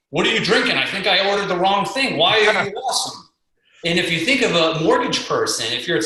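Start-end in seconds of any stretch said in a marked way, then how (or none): 0.1-3.3 higher-pitched incredulous stranger; amused disbelief. Retold or imitated. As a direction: quote him, pitched up and puzzled. Acting out stranger's baffled question.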